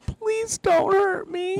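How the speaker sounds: high, slow whimpering plea; mock pleading. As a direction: beg for mercy in a tiny cracking voice. Begging Guido in retold scene.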